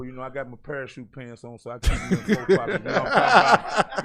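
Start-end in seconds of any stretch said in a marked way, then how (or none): 1.6-4.0 laughing. Real, not acted. Joking about pockets, laughing.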